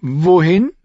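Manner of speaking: high, rising question; hesitant young girl. A narrator's reading. Girl unsure of following him, high questioning. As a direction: ask in a high girl's voice.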